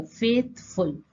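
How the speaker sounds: "Faithful" slowly, broken into फेथ and फुल; two separate beats in "faithful"; split into syllables. Shown as a demonstration. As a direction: say फेथ, pause, then फुल. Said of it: "फेथ ये अलग हो गया".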